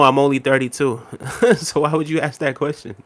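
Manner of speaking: ordinary talk, nothing marked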